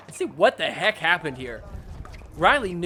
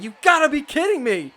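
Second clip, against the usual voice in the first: high, loud mock disbelief; hammed-up annoyed player; exasperated player; 9 semitones higher, 6 dB louder than usual. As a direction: whine it like a frustrated pro. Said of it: "Fed is just like".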